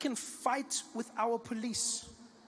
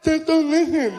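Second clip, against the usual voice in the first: deaf man's speech; 10 semitones higher, 13 dB louder, slower than usual. Retold or imitated. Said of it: "He's like".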